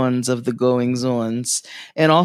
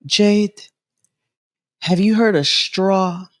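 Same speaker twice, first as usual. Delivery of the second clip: a lightly higher grandma voice; 6 semitones higher, slower than usual. Retold or imitated. Grandma asking her granddaughter about Straw, lightly voiced. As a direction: quote grandma with a light lift.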